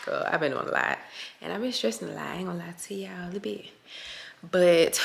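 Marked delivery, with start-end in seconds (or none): 1.4-3.7 quick whispered aside, much softer; whispered confession to viewers; confiding whisper. Real, not acted. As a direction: let it slip out in a fast whisper. Confiding aside about stress.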